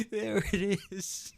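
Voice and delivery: high, soft laughing words. Real, not acted. Laughing at his own joke.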